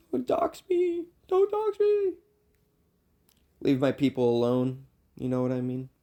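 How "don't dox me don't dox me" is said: mock panic. Pleading with the internet, mock panic.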